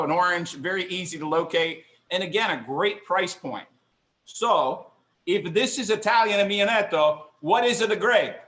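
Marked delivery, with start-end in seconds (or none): 4.2-8.3 hammed-up Italian accent, higher and louder. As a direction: ask it like a stage Italian. Mock Italian accent continues in question.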